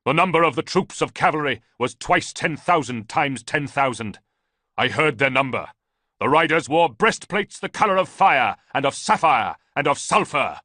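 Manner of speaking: loud, high fervent chant; ranting. A narrator's reading. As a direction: rave the vision at Ballista. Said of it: "Theodotus continued to rant".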